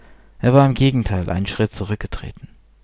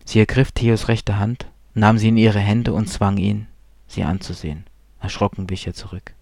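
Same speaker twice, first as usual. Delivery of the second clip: ordinary delivery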